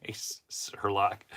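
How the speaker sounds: slow, sarcastic whispered jab. Real, not acted. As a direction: breathe it out as a dry put-down. Sarcastic aside after mocking media.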